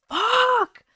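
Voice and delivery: sharp high yelled swear; yelled curse. Retold or imitated. Frustrated at a hacked account, a high yelled curse. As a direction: shout it out in frustration. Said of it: "I literally yelled".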